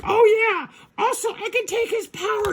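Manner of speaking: very high gloating villainess; Rita. Acted. Villain boasting she can take his power, high squeal. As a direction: squeal it like a cartoon schemer.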